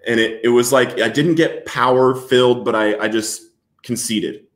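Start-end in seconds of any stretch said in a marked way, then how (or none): none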